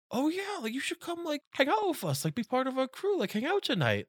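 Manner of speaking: high-pitched put-on bro voice; tipsy guy imitation. Tipsy guy inviting woman on bus, mock high voice.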